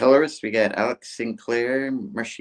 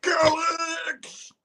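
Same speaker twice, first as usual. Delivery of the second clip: high hammed-up cry of a name; battle cry. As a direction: yell it leaping into the fight. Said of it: "He's like".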